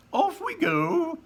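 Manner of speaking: high, slow sing-song showman; Wonka voice. A narrator's reading. Wonka calls his visitors to the boat, high and gleeful. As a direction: launch the trip with showman glee. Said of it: "said Mister Wonka".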